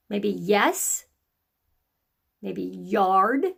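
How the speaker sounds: usual speaking voice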